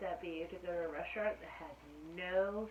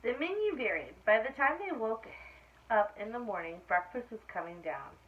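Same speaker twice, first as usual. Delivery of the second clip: lively, louder read-aloud voice; story reading to children; 9 dB louder than usual. A narrator's reading. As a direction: read it brightly to a class of kids. Reading picture book aloud.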